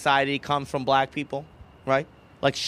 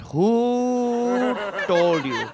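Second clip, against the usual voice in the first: very drawn-out, higher "Who", hammed-up outrage; pissed mom; 6 semitones higher, much slower than usual. Mom demands the culprit's name, exaggerated pissed. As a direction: hold "Who" long and high, accusingly. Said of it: "she just lands at a sidewalk pissed".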